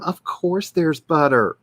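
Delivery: slow drawled Southern accent; slight Southern drawl, unhurried. Real, not acted. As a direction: lean into the Southern accent, slowly. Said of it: "my accidental accent".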